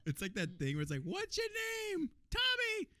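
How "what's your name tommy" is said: high, slow mock shout; comic shouted exchange. Acting out a name exchange that ends in a high shouted insult. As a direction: yell the punchline high and slow.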